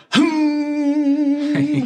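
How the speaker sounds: high, sustained mock meditation hum; yoga hum. Retelling a radio host's yoga bit, mock meditation hum. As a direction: drone a long high "hmm" like bad yoga.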